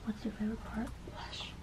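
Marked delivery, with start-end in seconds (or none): 1.1-1.5 breathy whispered aside. Real, not acted. Answering her own question about blush, whispered. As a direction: hush it to yourself.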